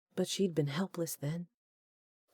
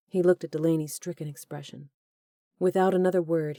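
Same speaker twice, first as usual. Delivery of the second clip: ordinary delivery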